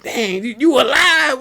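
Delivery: very high-pitched stunned disbelief; high, amazed movie reaction; retold astonishment. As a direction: act out a father's shock at the sight. Acting stunned father seeing living son.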